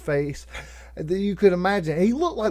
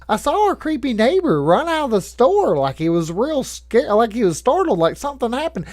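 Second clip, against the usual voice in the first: a lightly raised, lightly voiced mom asking questions; curious mom; 6 semitones higher, 4 dB louder than usual. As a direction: play mom, puzzled and nosy, in a light voice. Mom quoted asking what happened.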